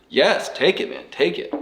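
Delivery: high-pitched, emphatic encouragement; retold eager urging. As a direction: re-enact urging a friend to grab it. Retelling his urging to take offer.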